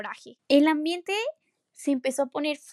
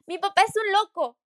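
exasperated inner outburst; 7 semitones higher, 7 dB louder than usual. Acted. Inner thought, angry teen.

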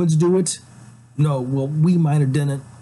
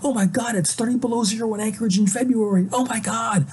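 higher, fast fake alarm; mock panic; 7 semitones higher than usual. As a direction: ham up a freak-out over the cold. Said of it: "if I were to say".

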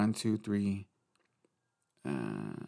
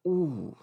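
swooning ooh; 8 semitones higher than usual. Retold or imitated. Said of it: "you go".

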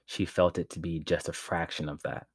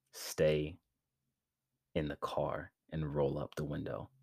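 hushed, much softer near-whisper growl; low growl; 7 dB quieter than usual. A narrator's reading. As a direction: drop to a whispered warning. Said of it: "Malcolm's voice deepened to a growl".

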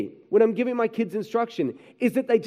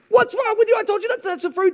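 worked up; 10 semitones higher, faster than usual. Retold or imitated. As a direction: snap at the kids over the fruit. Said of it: "you're getting all worked up about it".

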